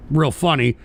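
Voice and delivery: ordinary talk, nothing marked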